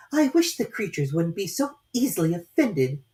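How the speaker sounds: put-on high, light young girl voice; a polite little girl, voiced high; Alice's girlish voice. A narrator's reading. Alice answering the caterpillar in a high girlish voice.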